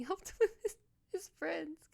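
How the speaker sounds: tearful laugh breaking through; real crying laugh. Real, not acted. Streamer watching a friend's death scene, laughing through tears. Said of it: "I don't want to cry".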